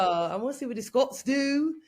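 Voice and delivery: a badly faked Scottish accent, laughing; attempted Scottish accent. Traveler telling friends about Edinburgh invite, mock Scottish accent. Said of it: "I don't even know the accent".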